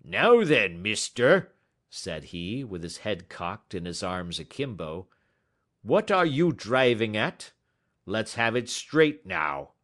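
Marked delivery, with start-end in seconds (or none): 0.0-1.5 loud, rough challenge; angry salesman. A narrator's reading. Angry salesman to Holmes, loud and hostile. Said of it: "a burst of anger from the salesman". 5.8-9.7 louder, demanding challenge; angry salesman. A narrator's reading. Salesman confronting Holmes, loud and demanding. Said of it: "a burst of anger from the salesman".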